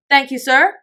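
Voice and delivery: high, triumphant servant's reply; note of triumph. A narrator's reading. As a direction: answer as if just proved right. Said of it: "with a note of something like triumph in his voice".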